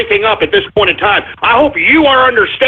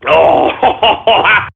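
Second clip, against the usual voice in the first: laughing; 2 semitones lower than usual. Acted. Laughs after taking a shot.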